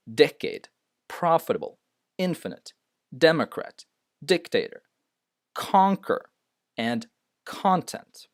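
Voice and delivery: each word high on its first syllable, then falling: Decade, Profitable, Infinite, Democrat, Dictator, Conquer, content; word list, DEM-ocrat style front stress. Shown as a demonstration. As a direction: punch DEC in Decade, CON in Conquer and content. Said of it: "the first syllable".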